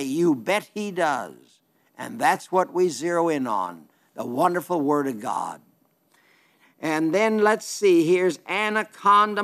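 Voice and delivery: normal talking voice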